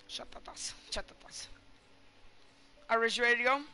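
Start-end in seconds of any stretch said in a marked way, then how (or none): 0.1-1.5 whispered name. Real, not acted. Muttering the name.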